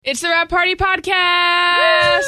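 big shouted show intro; forced excitement. Acted. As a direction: announce it loud and pumped. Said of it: "that sounds slightly forced excitement".

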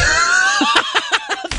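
real high cackling laugh; high-pitched laugh. Real, not acted. Host cracking up at a bad accent, high laugh.

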